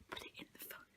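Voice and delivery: hushed suggestion; whisper. Real, not acted. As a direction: whisper the idea. Hiding a book, S0 nearby.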